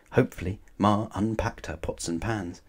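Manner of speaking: ordinary delivery, nothing marked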